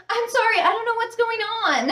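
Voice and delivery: put-on a girl squeaking out sorry in a high voice; high apologetic girl. Flustered passenger apologizes to the flight attendant, high and nervous. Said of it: "and she's like".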